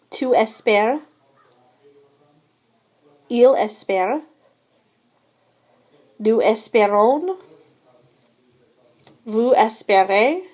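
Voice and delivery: each form said clearly, with pauses for repeating; 'Je préfère' through 'Ils espèrent' modelled in careful French; French conjugation drill. Shown as a demonstration. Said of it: "répétez préféré". (prononciation française claire et posée, à répéter)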